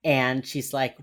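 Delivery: ordinary talk, nothing marked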